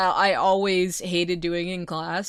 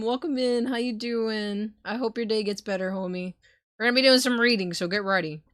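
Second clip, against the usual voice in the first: ordinary talk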